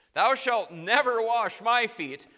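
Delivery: loud, high, slow refusal; emphatic protest. Retold or imitated. Preacher acting Peter refusing Jesus, loud and slow. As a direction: boom out the refusal. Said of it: "Peter's abrupt about face".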